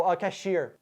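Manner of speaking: normal talking voice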